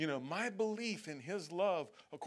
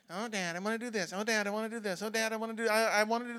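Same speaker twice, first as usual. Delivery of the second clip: put-on higher, louder whining kid voice, rushed at the start; whining child; 6 semitones higher, 5 dB louder, much faster than usual. A child whining at Dad for permission, higher. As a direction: whine it at Dad over and over. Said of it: "and whine and whine".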